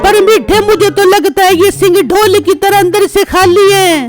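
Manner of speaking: wife's voice, complaining. Acted. Wife complains to husband. (पत्नी की ऊँची आवाज़, शिकायत भरी)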